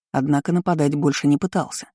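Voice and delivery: plain reading voice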